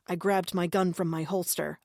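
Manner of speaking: plain reading voice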